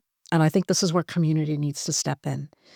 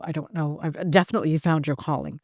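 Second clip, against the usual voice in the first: ordinary talk, nothing marked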